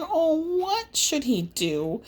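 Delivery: plain reading voice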